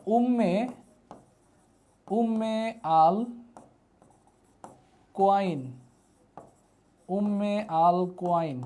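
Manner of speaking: very slow, word by word with long pauses; slow dictation. Shown as a demonstration. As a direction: dictate "Umme আল কোয়াইন" piece by piece, stretching each word. Teacher dictating a new name slowly. (ধীরে ধীরে ভেঙে ভেঙে শ্রুতলিখন)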